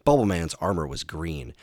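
plain reading voice

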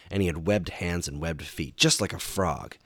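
plain reading voice

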